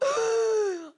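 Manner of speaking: sharp high gasp of cold shock. Retold or imitated. Drenched mother gasps at the cold water, high and sharp. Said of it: "I'm all".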